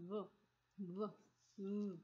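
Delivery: v held with a buzz in the throat; voiced v sound. Shown as a demonstration. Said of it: "a lot of vibration I can feel in my throat".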